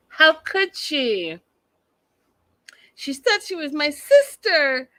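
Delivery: high, loud imitation of a wailing breakdown; mock ugly cry. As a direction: mimic her distraught wail, drawing it out. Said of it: "she's doing, like, the ugly cry".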